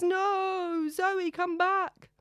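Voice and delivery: high, slow, loud wail of despair; anguished wail, drawn out high. A narrator's reading. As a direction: cry after her as she runs from the altar. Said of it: "He wails".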